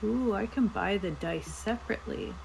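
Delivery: ordinary delivery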